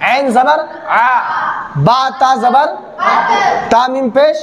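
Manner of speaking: slow letter-by-letter spelling, last part called out. Shown as a demonstration. Teacher spelling out the word slowly for students. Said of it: "हिज्जो किस तरीके से करेंगे".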